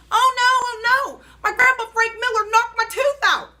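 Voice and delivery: loud, high, panicky oh no; panicked shout. A narrator's reading. Girl running through the house after losing a tooth, panicked shout. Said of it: "I shouted".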